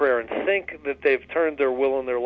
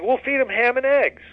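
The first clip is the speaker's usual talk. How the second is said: much higher, chirpy chicken punchline; 9 semitones higher than usual. Retold or imitated. Chicken springing the ham and eggs plan, high and cheeky.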